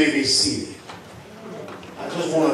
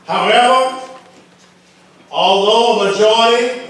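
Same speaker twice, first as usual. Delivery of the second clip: raised preacher's voice with weighty pauses; forceful preaching; 4 semitones higher than usual. Real, not acted. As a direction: drive each phrase out to the congregation, higher pitched. Preaches to congregation.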